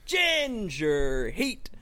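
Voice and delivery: big, high opening easing down to a teasing hush; hammed-up announcer intro. Host welcoming everyone to the podcast, showy announcer voice.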